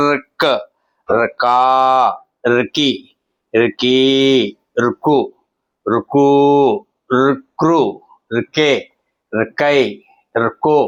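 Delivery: rolled half r into each vowel: ర్కా, ర్కి, ర్కీ; long vowels held in ర్కా, ర్కీ, ర్కూ; vowel-series drill. Shown as a demonstration. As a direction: recite ర్క్, ర్కా, ర్కి one by one, pausing between. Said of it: "గుణింతం ఎలా మారుతుంది?".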